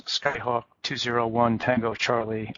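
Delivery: usual speaking voice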